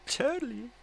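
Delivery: very high, squeaky Totally; high mock voice. Joking after his shriek, high squeaky voice. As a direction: pitch it way up in jest.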